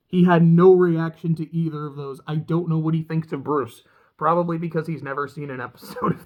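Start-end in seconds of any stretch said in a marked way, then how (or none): none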